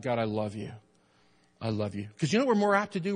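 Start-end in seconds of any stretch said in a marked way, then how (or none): 0.0-2.1 tender prayer. Shown as a demonstration. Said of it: "get in the habit of saying to God".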